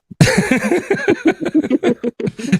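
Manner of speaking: high-pitched real laughing fit; long, high burst of laughter. Real, not acted. Host cracks up at a guest's joke, high laughing.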